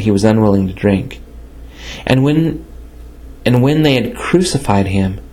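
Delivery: plain reading voice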